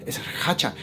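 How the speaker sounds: hushed, breath-only warning; frightened whisper. Retold or imitated. Terrified lady names the creature, frightened whisper. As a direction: whisper the dreaded name in fear.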